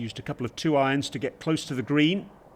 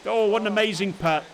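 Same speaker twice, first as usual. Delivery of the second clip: excited; 6 semitones higher than usual. Real, not acted. Putt drops, commentator exclaims.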